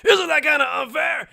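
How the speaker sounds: indignant complaint. Acted. Ganju protesting to the others about unfairness.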